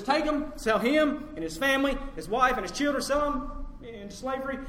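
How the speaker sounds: loud, high-pitched order; commanding master. Acted. Master ordering his debtor sold into slavery, loud and commanding. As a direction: hand down a forceful sentence.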